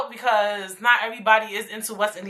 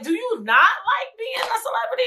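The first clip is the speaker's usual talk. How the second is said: much higher, disbelieving question; sky-high mock disbelief; incredulous; 12 semitones higher than usual. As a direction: ask it in pure disbelief. Incredulous imagined question to rapper.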